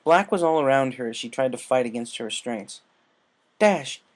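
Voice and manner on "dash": louder, higher urgent wake-up calls; rousing a sleeping friend; urgent calling. A narrator's reading. Applejack wakes Rainbow, urgent calling. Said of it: "Applejack said".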